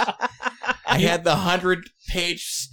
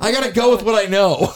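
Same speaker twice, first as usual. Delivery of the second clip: loud, higher-pitched defence through laughter; laughing protest; 4 semitones higher, 7 dB louder than usual. Real, not acted. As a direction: protest loudly while cracking up. Defends self amid group laughter.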